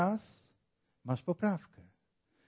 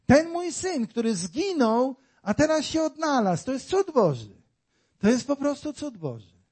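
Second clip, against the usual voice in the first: higher, louder rejoicing over a lost son found; a father's raised, rejoicing cry; joyful father; 7 semitones higher, 4 dB louder than usual. Acted. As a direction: exclaim it like a father overjoyed.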